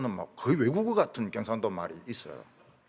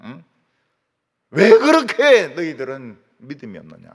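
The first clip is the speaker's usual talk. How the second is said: a touch of Jesus' stern rebuke, louder on the last question; rebuking; 6 dB louder than usual. Retold or imitated. Jesus rebuking his unbelieving disciples, stern loud scolding. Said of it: "책망하시는 겁니다".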